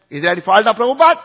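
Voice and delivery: loud, fast, rising question; insistent demand. Real, not acted. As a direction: fire the question out, pressing for an answer. Insistent rhetorical question to audience.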